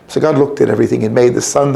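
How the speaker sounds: ordinary delivery, nothing marked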